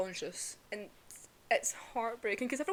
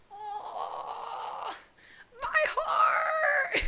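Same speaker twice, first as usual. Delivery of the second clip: high-pitched, tearful cry rising to loud; heartbroken wail; 12 semitones higher, 3 dB louder, much slower than usual. Real, not acted. Fan overcome by the ending, loud high heartbroken wail. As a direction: wail it out, building from a moan to a shout.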